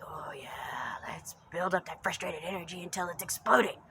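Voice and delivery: whispery mock glee, starting hushed and building; mock relishing. Mocking someone cultivating frustrated energy, whispery relish. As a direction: egg it on in a stagey half-whisper. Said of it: "You're gonna be like".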